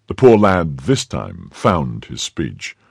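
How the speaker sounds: ordinary delivery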